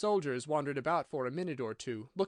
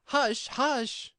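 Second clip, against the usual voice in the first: high, urgent warning; hurried hushing; 10 semitones higher, 6 dB louder than usual. A narrator's reading. Rabbit silencing Alice, urgent anxious hushing. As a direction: shush urgently in a raised, high voice. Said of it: "in a low, hurried tone".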